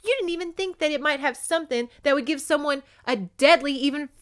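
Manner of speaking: high, loud angry rant; indignant tirade. Acted. Woman scolds captor for poisoning his men, high and angry. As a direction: tear into him, outraged.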